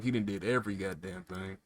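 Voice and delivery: ordinary talk, nothing marked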